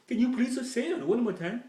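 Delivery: high, loud, confused waiter voice; baffled waiter. Retold or imitated. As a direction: lean in, straining to hear. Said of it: "And the waiter's like".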